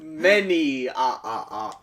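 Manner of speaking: Transylvanian vampire count. Pretend. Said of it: "Your count voice, I hate".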